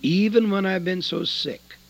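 normal talking voice

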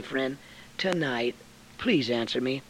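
ordinary delivery

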